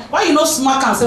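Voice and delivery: normal talking voice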